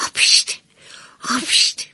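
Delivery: two little breathy sneezes; acted sneeze. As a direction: sneeze twice, small and airy. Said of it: "سرد شد و عطسه کرد".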